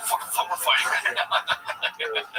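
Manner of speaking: high, slow, laughing through the words; laughing mock panic. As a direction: giggle out a mock alarm.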